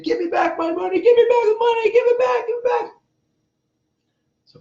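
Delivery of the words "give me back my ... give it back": high, loud, desperate yelling of give me back my money; retold screaming. Billionaire screams at the fleeing guru, high and loud. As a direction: shriek it like a robbed man chasing his cash. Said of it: "like, screaming".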